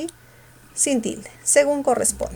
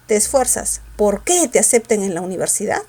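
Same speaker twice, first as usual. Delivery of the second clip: "porque" punched on its last syllable, "que"; stress on QUÉ. Shown as a demonstration. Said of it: "entonces el qué se tildaría".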